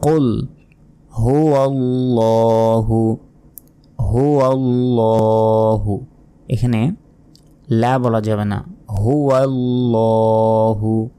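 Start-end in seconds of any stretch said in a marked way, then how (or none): 1.2-6.1 হুয়াল্লাহু with the lla held one second; stretched la. Shown as a demonstration. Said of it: "লতে এক second টেনে পড়বেন". 8.9-11.1 হুয়াল্লাহু with the lla held one second; stretched la. Shown as a demonstration. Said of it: "লতে এক second টেনে পড়বেন".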